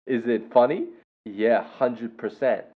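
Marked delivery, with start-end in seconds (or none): none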